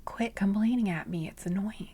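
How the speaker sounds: hushed, confiding grumble; whispered aside. Real, not acted. Reader to viewers, grumbling in a whisper. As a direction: whisper the annoyance like a secret.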